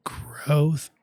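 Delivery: losing voice, cracks high; hoarse voice breaking on the word; voice cracking. Real, not acted. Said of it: "I'm losing my voice here".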